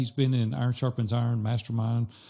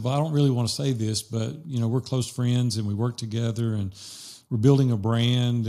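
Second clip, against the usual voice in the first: ordinary delivery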